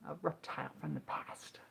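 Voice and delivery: hushed whisper, spooky aside; voiceless dramatic whisper. Acted. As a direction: breathe it out like a secret. Spooky aside about a dinosaur.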